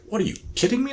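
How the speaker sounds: high, scoffing disbelief at the request; a priest who can't believe what he's hearing; incredulous priest. Retold or imitated. Priest scoffing at a mass for a dog, incredulous.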